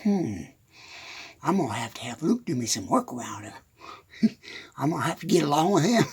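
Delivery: low, quiet musing as the wife; wife scheming to herself. Retold or imitated. Wife plotting to get work out of Luke, low musing. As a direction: think aloud softly, imitating the wife. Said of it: "She's going".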